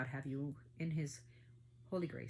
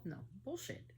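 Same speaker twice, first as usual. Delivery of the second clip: usual speaking voice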